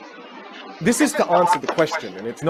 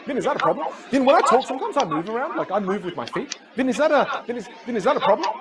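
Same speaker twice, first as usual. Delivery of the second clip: higher, jumpy pleading voice; imitated anxious questioner; 5 semitones higher than usual. Student asks coach about hand habits, higher worried questioner voice. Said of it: "So they say things like this".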